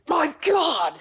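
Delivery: shocked cry. Acted. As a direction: cry out in horror at the injured friend. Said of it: "the surface gnome was like".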